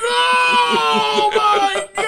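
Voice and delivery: high, loud shocked yell; shocked shout. Retold or imitated. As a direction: shout in disbelief. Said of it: "And I was like".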